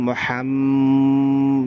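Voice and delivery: মুহাম- with a long hummed m; slow, level nasal hold ending মুহাম-; ghunna on mim. Shown as a demonstration. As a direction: hold the doubled m of মুহাম- through the nose. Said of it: "মিমও একালিফ গুন্না হবে".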